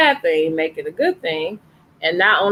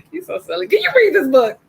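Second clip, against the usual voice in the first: teasing, pitched much higher; playful teasing; 11 semitones higher, 4 dB louder, faster than usual. Real, not acted. Teacher teasing the boy reading his handsome line, playful and high. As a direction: tease him fondly and high.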